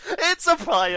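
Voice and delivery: high, loud game-show shout; soaring, shouted announcement; excited reveal. Real, not acted. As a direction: yell the answer triumphantly. Host announces the answer.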